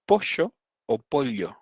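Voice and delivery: POLLO first as "posho", then as "pol-yo", slowly; pollo variants. Shown as a demonstration. Teaching the waiter Argentine forms, pollo as posho or polyo. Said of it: "distintas formas de".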